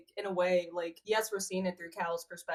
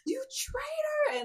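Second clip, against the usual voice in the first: high, drawn-out cry of accusation; retold scream; slower than usual. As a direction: screech it slowly at the screen, without full volume. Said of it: "I just remember screaming at my computer".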